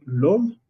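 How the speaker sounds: l'homme said as lom; French demo. Shown as a demonstration. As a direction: model l'homme. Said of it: "Como por ejemplo". (l'homme como modelo)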